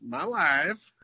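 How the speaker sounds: put-on a light Borat accent on my wife. Quoting Borat in a light Borat accent. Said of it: "tapers off into Borat".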